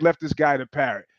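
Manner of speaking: ordinary talk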